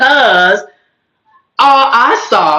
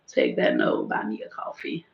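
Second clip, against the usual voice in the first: muttered note to self; 13 dB quieter than usual. Real, not acted.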